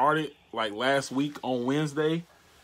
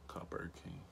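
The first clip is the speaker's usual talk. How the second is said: whisper; 8 semitones lower, 18 dB quieter, faster than usual. Real, not acted. Voice command to phone.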